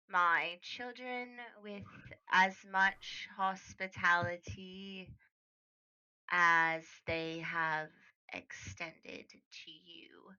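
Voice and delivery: measured, slow and deliberate; slow mother's request. Acted. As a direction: lay each word down slowly, like a final request. Mother's parting request, one more time.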